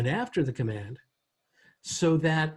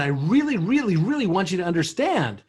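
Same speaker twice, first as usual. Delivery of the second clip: REALLY punched louder each time it repeats; excited emphasis; 3 semitones higher, 6 dB louder than usual. Shown as a demonstration. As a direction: build loud, high stress on every REALLY. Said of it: "I'm gonna pick up the volume".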